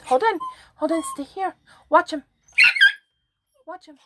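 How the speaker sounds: high, coaxing pet voice; coaxing the little dog in a high voice. Real, not acted. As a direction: egg the chihuahua on sweetly.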